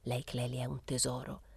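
low, soft murmur close to the cheek; whispered. A narrator's reading. Febo whispering on Clelia's cheek, whispered. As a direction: say it softly, as a confidence. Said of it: "bisbigliò sulla guancia".

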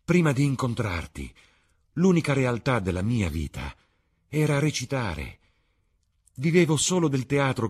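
lost in joy, pouring her heart out; rapturous speech. Acted. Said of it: "trasfigurata dalla gioia". (Sibyl confessa a Dorian, estasiata e più acuta)

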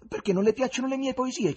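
high, loud curious query; keen, insistent curiosity; curious question. A narrator's reading. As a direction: ask eagerly, pitched right up. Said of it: "chiese Ivan con curiosità".

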